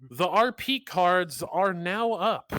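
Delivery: slow loud announcement. Real, not acted. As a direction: announce it slowly, loudly. Announcing RP start.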